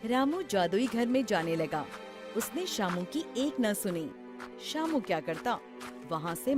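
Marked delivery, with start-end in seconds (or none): none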